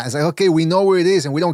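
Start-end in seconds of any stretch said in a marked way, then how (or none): none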